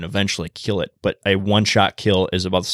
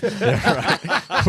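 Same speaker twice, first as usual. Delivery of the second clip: much higher, laughing yeah right; laughing sarcasm; 9 semitones higher than usual. Real, not acted. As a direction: scoff through laughter. Sarcastic reply while laughing.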